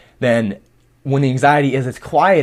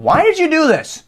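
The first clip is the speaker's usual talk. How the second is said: conscience nagging; 12 semitones higher than usual. Retold or imitated. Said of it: "my conscience ... saying".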